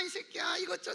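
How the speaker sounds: usual speaking voice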